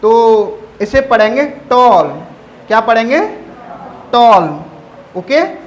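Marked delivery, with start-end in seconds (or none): 1.5-2.1 टॉल with a loud held "aw", "tawwl"; long o. Shown as a demonstration. Teacher showing how to read it, टॉल with long vowel. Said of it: "बड़ा ओ के साथ है". 4.1-4.6 टॉल lower and louder, vowel held as "tawwl"; long o. Shown as a demonstration. Teacher repeating for students, टॉल held long. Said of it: "बड़ा ओ के साथ है".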